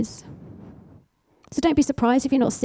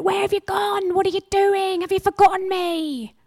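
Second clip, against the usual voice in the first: lamenting plea; 8 semitones higher than usual. Acted. Voicing David's lament psalm.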